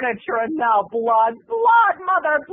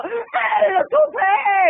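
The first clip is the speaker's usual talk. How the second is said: mock outrage; 11 semitones higher, much slower than usual. Comic wails about the coming revenge. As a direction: drag it out in hammed-up fury.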